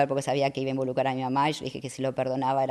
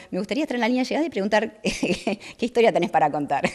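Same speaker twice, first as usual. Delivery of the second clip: higher, laughing through the words; laughing wish; 7 semitones higher, 3 dB louder than usual. Retold or imitated. To the host, imagining asking finishers their stories, laughing. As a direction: chuckle as you picture asking it. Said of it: "Siempre digo".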